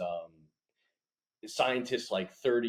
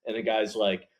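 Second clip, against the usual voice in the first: normal talking voice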